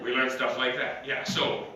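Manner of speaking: normal talking voice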